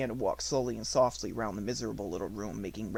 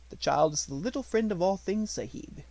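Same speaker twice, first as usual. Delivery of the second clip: a lightly higher, respectful servant's voice; devoted servant Ramdas; 7 semitones higher, 3 dB louder than usual. A narrator's reading. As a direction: speak up gently for the child you watch over.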